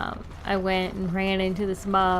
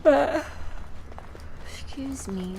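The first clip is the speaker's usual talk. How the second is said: but, stretched by a yawn; 7 semitones higher, slower than usual. Real, not acted. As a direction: talk through a yawn. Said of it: "I'm sorry that I'm yawning".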